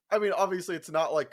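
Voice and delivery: ordinary talk, nothing marked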